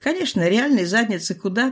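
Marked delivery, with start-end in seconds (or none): none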